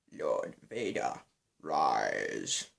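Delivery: very low, slow villain impression; Emperor. Fan performs a requested Star Wars impression on camera, deep Emperor voice. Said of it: "do an Emperor impression".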